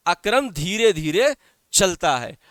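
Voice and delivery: ordinary delivery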